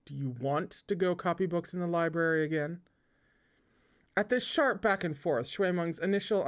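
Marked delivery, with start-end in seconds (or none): none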